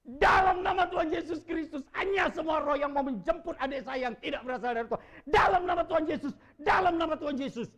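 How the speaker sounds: loud, high, driving prayer of command; shouted invocation. Retold or imitated. Preacher invoking Jesus' name over his brother, loud shout. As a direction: declare the name with full force. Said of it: "Saya cuma bilang begini".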